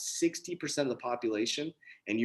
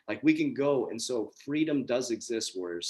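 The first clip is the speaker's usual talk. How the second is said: ordinary delivery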